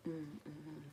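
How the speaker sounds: ordinary delivery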